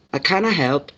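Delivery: "I kinda help" with a Scottish lilt; imitating Glasgow accent. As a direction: lightly mimic a Glaswegian friend. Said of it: "there's other words".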